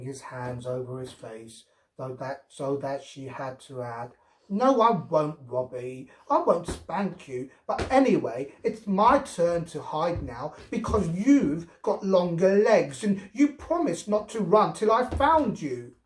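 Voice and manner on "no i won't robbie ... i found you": put-on higher child voice, eager and bossy; lightly voiced little girl. A narrator's reading. Girl making the rules of hide and seek with Robbie. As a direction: reassure then insist, childishly. Girl reassuring then bargaining.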